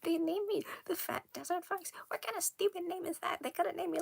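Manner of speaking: high, outraged character voice; indignant outrage. Acted. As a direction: sputter at the stupid name. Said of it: "be furious, obviously".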